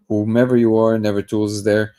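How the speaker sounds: ordinary delivery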